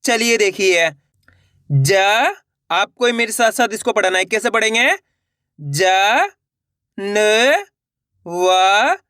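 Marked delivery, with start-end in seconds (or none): 1.7-2.3 ज held long, tune rising at the end; letter-by-letter demo. Shown as a demonstration. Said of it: "एक एक अक्षर का उच्चारण". 5.6-9.0 ज, न, व each separate, held long and rising; letter-by-letter demo. Shown as a demonstration. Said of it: "एक एक अक्षर का उच्चारण".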